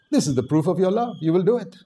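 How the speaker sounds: ordinary talk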